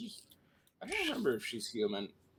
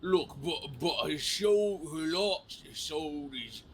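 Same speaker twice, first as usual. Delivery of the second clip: exaggerated London Cockney voice; hammy Cockney accent; bad Cockney accent; 3 semitones higher than usual. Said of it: "You do the bad Cockney accent too well".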